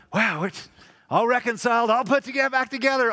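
high, loud happy relief; relieved joy. Retold or imitated. Someone thrilled after a reconciliation, loud and high. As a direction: celebrate the breakthrough. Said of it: "you walk away going".

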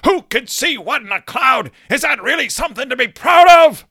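mock furious grandpa building to a shout; angry Gramps. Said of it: "he looks angry".

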